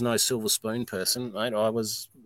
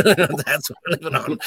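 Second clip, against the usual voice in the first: laughing; 5 semitones higher, 6 dB louder than usual. Real, not acted. Telling the host about the pantry, laughing. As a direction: laugh it out, a bit louder. Laughing at his hard times.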